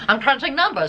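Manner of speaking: playful hint of a twenties New York banker; 1920s stockbroker.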